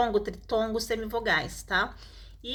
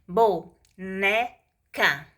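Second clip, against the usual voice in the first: very slow "Boneca", long gaps between bo, né, ca; syllable by syllable; 2 semitones lower, much slower than usual. Shown as a demonstration. Teacher showing each syllable has a sound, syllable by syllable. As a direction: sound out "Boneca" one separate syllable at a time. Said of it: "Cada sílaba dessa, ela tem um som".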